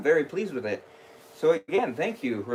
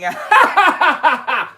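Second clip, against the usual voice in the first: loud villainous cackle; evil laugh; 14 semitones higher, 11 dB louder than usual. Acted. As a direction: maniacal high cackle. Laugh after scary shout.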